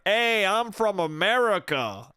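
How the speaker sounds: drawn-out, exaggerated American accent; shitty American accent. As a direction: imitate a bad impression of an American. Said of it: "they'll be like".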